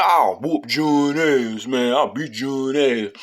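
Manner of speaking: slow, drawn-out cocky boast through a grin; lightly voiced smug kid bragging with a grin; a hint of a cocky grin, slowed right down; grinning tough talk. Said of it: "Smiling with that crook".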